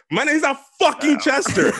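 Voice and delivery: snapped retold. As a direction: snap at the coworker, loud and high. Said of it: "I snapped".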